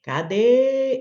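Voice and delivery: "Cadê" with a long drawn-out final DÊ; teacher stretching DÊ in "Cadê"; stressed syllable held. Shown as a demonstration. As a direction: hold the second syllable of "Cadê" to show it is strong. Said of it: "qual é a sílaba forte aqui".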